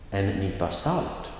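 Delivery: salt lifted above En nypa; stress on salt. Shown as a demonstration. Teacher models an example phrase, stress on salt. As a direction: lean on the last word, salt. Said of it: "the noun will get the emphasis".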